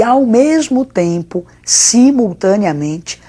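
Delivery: ao mesmo tempo drawn out slowly and loud; slow emphatic stress. Shown as a demonstration. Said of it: "teacher stresses simultaneity".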